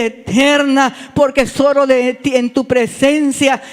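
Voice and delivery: loud, slow, weighty pleading; fervent preached prayer. Acted. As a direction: build into impassioned confession to God.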